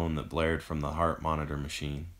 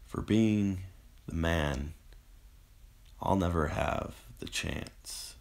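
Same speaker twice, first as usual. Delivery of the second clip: halting phrases fading to breath; broken, slowing, dying words; barely managed. A narrator's reading. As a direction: eke out each phrase with failing strength. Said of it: "Eric managed".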